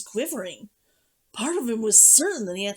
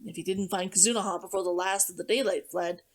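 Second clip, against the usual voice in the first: usual speaking voice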